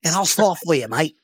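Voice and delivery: put-on Australian accent. Player making the coach an offer, mock Australian accent. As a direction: play him as a mock Aussie bargaining. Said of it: "That's why I do the Australian".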